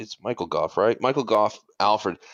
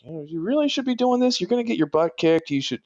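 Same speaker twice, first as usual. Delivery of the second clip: mock nagging butler; 9 semitones higher, faster than usual. Imitating Michael Caine's Alfred.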